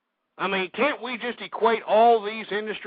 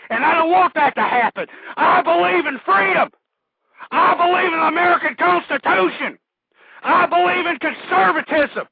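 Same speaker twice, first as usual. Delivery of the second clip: higher, louder, building to a scream; ranting scream; 9 semitones higher, 7 dB louder than usual. Real, not acted. Radio host screams his beliefs at the callers, full rant. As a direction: rant at the listeners with everything you have. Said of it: "I don't mean to be screaming out my head".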